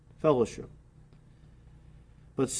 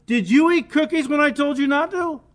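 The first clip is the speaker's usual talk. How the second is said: scolding mom; 10 semitones higher than usual. Retold or imitated. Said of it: "turns to her five-year-old and says".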